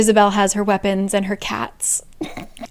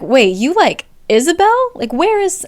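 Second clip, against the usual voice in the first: high, louder, incredulous question; startled disbelief; 7 semitones higher, 4 dB louder than usual. Retold or imitated. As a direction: act taken aback, pitch leaping up. Acted startled question to Sebastian.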